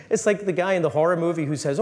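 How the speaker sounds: usual speaking voice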